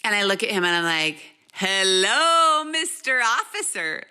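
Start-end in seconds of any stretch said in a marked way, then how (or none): 1.5-4.0 sugary, teasing hello to the cop; playfully mocking welcome; mock sweet greeting. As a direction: greet him like you're in on the joke. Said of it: "like that".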